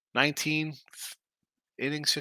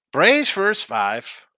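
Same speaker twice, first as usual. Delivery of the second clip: loud announcement; 7 semitones higher, 8 dB louder, much slower than usual. Acted. Big announcement of the bet.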